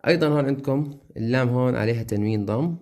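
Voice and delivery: ordinary talk, nothing marked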